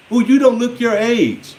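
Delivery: mimicking a gushing stranger; imitated admirer. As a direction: coo it like someone flattering you. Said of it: "Next time somebody say that to me".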